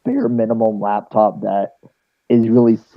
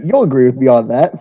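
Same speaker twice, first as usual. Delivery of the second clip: normal talking voice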